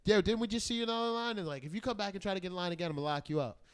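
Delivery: quick, high retold warning; imitated doorman's warning. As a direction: lightly act out a doorman catching someone out.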